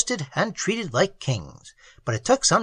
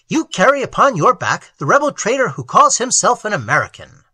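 loud, higher angry accusation; 5 semitones higher, 7 dB louder than usual. A narrator's reading. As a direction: denounce the traitor loudly. Sentry accusing Enriquez.

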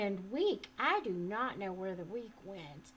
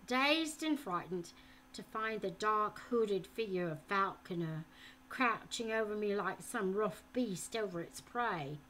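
usual speaking voice

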